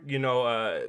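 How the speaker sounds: ordinary talk, nothing marked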